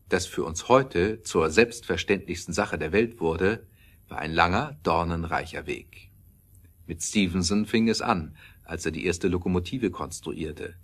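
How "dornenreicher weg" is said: normal talking voice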